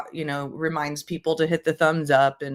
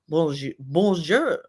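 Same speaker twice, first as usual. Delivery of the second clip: mock French. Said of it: "I'm really bad at them".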